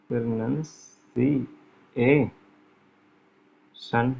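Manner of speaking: Pronunciation drawn out very slowly while writing; very slow dictation of pronunciation; dictating slowly. Shown as a demonstration. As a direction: spell pronunciation out syllable by syllable, very slow. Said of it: "इसको मैं लिख देता हूँ".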